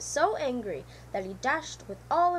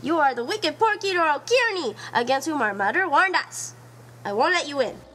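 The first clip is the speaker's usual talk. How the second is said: louder, pleading pig voice; scared refusal; 3 semitones higher, 7 dB louder than usual. Acted. Washfu refuses to let the fox in, loud and scared. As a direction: refuse him, frightened and firm.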